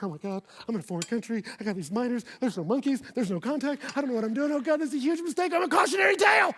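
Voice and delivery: fast panic building to a high, loud cry; panicked freak-out. Retold or imitated. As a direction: spiral into alarm until it bursts. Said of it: "I just have a full freak out".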